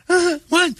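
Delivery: put-on very high, confused kid voice; woken child. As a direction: answer like a startled small child.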